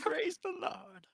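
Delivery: hushed echo. Acted. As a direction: echo it under your breath.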